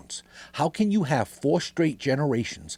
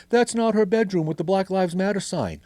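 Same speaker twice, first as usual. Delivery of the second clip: mocking critics; 8 semitones higher than usual. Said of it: "you douchebags are all like".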